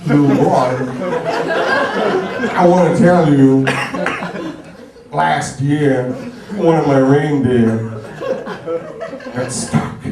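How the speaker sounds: halting, oddly paused Santa; a hint of Walken as Santa; Christopher Walken. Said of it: "bringing in Christopher Walken".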